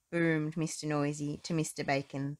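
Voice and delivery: ordinary delivery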